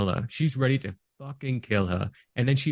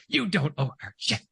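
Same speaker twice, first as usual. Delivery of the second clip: slightly higher mock film-character line; imitated film delivery; 4 semitones higher than usual. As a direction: quote it in her put-on voice. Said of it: "that delivery of that line".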